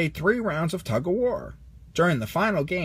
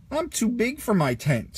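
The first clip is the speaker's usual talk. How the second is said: higher complaining voice; Rex complaining; 7 semitones higher than usual. A narrator's reading. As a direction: grumble up high, stuck. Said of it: "said Rex".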